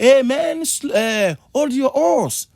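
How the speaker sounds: high, loud call-out; calling to a speeding driver. Acted. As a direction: call out "hold your horse" to someone racing ahead. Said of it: "tu peux lui dire".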